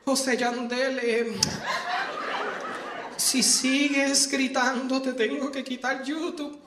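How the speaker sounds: mock timid threat, slow and hesitant; a hint of a jittery mother pleading; nervous mom. Nervous mom warning her kid about YouTube, mildly mocking. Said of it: "unas mamás tan más nerviosas".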